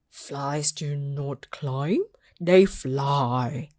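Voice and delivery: slow, lecturing low voice. Acted. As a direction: spell it out like a know-it-all friend.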